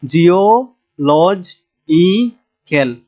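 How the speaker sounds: Geological very slowly, chopped into parts; drawn-out dictation of geological; syllable by syllable. Shown as a demonstration. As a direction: break geological apart with a pause after each piece. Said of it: "এখানেও তিন নাম্বারে এসে আমাদের".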